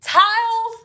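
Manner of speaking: high, disbelieving towels; can't believe what she's hearing; sharp disbelieving squeal; incredulous. Real, not acted. Outraged at the towel claim.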